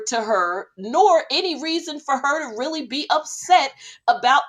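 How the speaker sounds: high, loud, exasperated ranting; worked-up rant. Real, not acted. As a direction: pile on the outrage. Heated rant about Mary.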